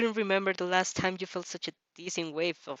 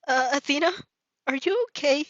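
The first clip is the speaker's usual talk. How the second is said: put-on higher, louder worried boy; higher anxious boyish call; Izuku, worried; 6 semitones higher, 6 dB louder than usual. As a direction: call out in alarm as the shy boy. Izuku sees her stagger.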